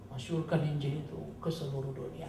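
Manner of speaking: ordinary talk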